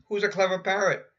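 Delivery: parrot voice. Acted. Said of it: "All she says is".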